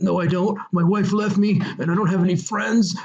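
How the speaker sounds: hammed-up last-breath self-pity; mock dying man. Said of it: "he's dying in their arms".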